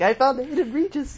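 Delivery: high excited shout of discovery; excited exclamation. Retold or imitated. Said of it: "It's like".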